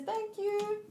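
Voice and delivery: high squeaky little voice; squeaky Nino voice. Pretend. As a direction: pipe it up tiny and high. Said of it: "Nino says".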